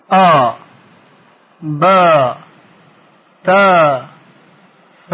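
each letter as a held syllable: aa, baa, taa, saa; chant-like drill, "ب" said baaa; long aa syllables. Shown as a demonstration. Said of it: "با صداهای کشیدهٔ بالا".

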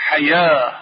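normal talking voice

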